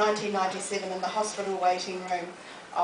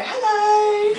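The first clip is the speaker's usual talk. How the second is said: much higher, louder hammed-up hello; bright mock hello; 12 semitones higher, 10 dB louder than usual. Mother greeting a phone caller by mistake, hammed-up and high. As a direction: chirp hello to a stranger's phone call. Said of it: "I go".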